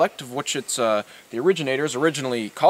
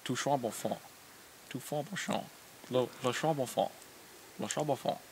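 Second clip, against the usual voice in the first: mumbled fake French; 3 semitones lower, 18 dB quieter than usual. Muttered fake French name.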